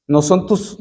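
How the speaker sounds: ordinary talk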